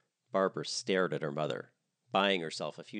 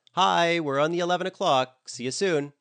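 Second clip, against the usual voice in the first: much higher, louder chirpy voice; cheery guest; 10 semitones higher, 11 dB louder than usual. Acted. A guest texts about arriving on the ferry, high and chirpy. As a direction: read it like a bubbly tourist.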